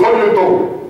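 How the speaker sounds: lightly put-on New York Italian greeting; New York Italian accent. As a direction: imitate a little Italian-American restaurant owner. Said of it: "Just imagine a little Italian guy".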